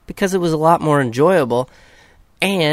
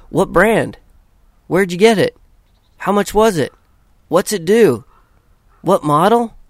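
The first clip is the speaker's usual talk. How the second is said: pestering questions; 6 semitones higher, 6 dB louder than usual. Retold or imitated.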